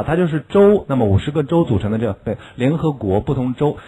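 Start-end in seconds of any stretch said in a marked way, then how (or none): none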